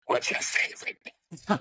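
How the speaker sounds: hoarse whispered imitation of an intimidating Englishman; blunt Englishman. Blunt English photographer confronts him at dinner, imitated in a whisper. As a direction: put him on in a harsh, voiceless whisper. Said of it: "very English, and he'll tell you".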